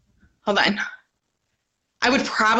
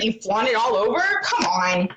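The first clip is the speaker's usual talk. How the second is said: ordinary delivery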